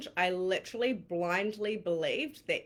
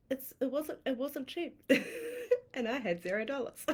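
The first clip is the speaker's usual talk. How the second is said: laughing; 6 semitones higher than usual. Real, not acted. Laughing at having no money.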